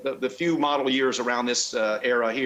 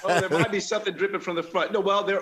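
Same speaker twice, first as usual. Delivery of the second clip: laughing along; 7 semitones higher than usual. Real, not acted. Joke about car dripping oil.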